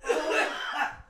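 high-pitched giggling laugh. Real, not acted. Laughing at own mix-up.

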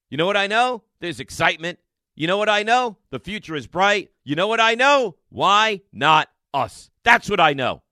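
a host's growing pep-rally call; rising rally cry. Real, not acted. Building rally cry to fans.

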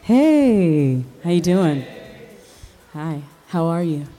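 ordinary talk, nothing marked

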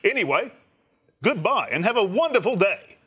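grand, swooping English actor voice; Matt Berry. Pretend. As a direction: sign off like a plummy British thespian. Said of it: "say goodbye in that voice".

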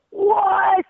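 imitating a high, stunned "what"; high-pitched mock disbelief; astonished fan. Retold fan's astonished reaction.

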